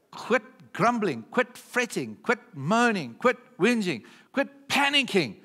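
rising rallying cry from the pulpit; building preaching call. Real, not acted. Preacher building a list of commands.

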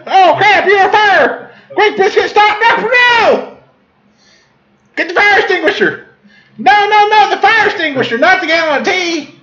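high-pitched comic alarm; hammed-up squealing emergency; mock panic. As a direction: panic over a fire in a squeaky voice. Acted birthday fire panic.

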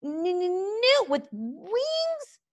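high, slow, offended stutter; stammering dragon repeat. A narrator's reading. Toothless repeating the insult back to Snotlout, high stammer. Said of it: "repeated Toothless".

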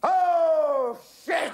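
long, loud, high "oh" then a short curse; horrified exclamation. A narrator's reading. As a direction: shout it as doom dawns. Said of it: "ejaculated Algy".

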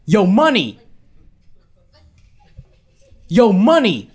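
loud calling out, yo money; hollering for attention across a room; mock yell across the room. Stranger yelling across the pizza place, loud calling out. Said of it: "just starts yelling from across the room".

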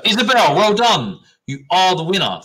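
loud and high, cheering the winner; excited announcement. Real, not acted. Teacher cheering the quiz winner in a loud, high announcement. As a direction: call out her name like a prize reveal.

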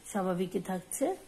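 ordinary talk, nothing marked

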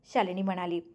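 ordinary delivery, nothing marked

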